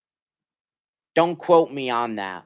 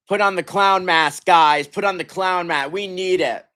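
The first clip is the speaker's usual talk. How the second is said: bitter loud joke; 9 semitones higher, 6 dB louder than usual. Real, not acted. Fan mocking his own team.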